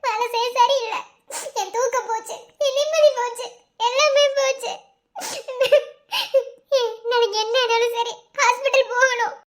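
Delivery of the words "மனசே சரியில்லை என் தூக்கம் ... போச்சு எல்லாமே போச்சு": tearful lament with sobs; crying. Acted. Wife telling her husband she has lost all peace, crying. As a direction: sob between short phrases. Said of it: "எதுக்கடி கொண்டு அழுதுட்டு இருக்க".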